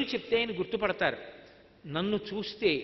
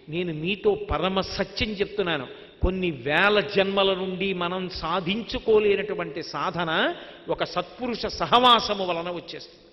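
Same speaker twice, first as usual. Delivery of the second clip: emphatic preaching; 5 dB louder than usual. Real, not acted. Preacher's earnest emphatic assurance.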